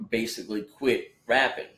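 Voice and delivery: normal talking voice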